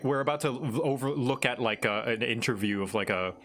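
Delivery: usual speaking voice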